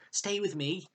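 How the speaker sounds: lightly voiced child asking her to stay. A narrator's reading. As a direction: keep her close, a little plea. Max holds Con back.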